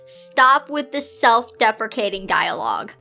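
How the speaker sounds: plain reading voice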